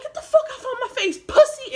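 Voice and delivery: high-pitched, building to a loud insult; acted-out confrontational outburst; angry taunt. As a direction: taunt him, hitting the last word hard. Acted quarrel with big guy.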